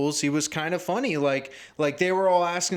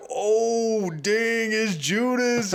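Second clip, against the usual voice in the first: higher, drawn-out dawning surprise; mock realization; 8 semitones higher, 3 dB louder, much slower than usual. John realizing who the betrayer is, high and slow. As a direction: slowly click that it's Judas.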